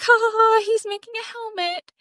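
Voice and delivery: high, amused, sing-song laugh; mock giggling mom. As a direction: giggle it out like a delighted grandma. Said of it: "And she's like".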